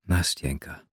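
low, soft and coaxing. A narrator's reading. Narrator softly coaxing weeping Nastjenka by name. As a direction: coax her gently, quietly. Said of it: "mit leiser, einschmeichelnder Stimme".

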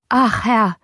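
lightly voiced eager servant; servant calling out. A narrator's reading. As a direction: call out to your master, eager to tell. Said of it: "rief der Diener".

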